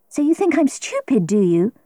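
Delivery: high-pitched, indignant challenge; angry, tired retort jumping up in pitch. A narrator's reading. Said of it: "I was angry and very".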